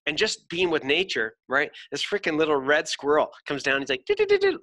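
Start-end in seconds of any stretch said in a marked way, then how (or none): 4.1-4.6 squeaky cartoon chipmunk, very high; chipmunk chatter. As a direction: chatter like Chip and Dale. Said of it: "remember Chip and Dale".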